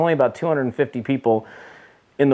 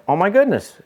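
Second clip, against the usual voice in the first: retold surprise; 8 semitones higher than usual. Recognising a PGA pro, amazed.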